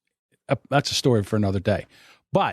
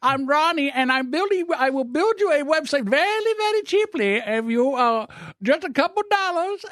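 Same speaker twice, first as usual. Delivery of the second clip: imitating the telemarketer. Retelling a telemarketer's call to his friend, high mocking caller voice. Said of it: "Hi, my name is".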